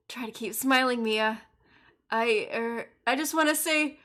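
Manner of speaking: ordinary talk, nothing marked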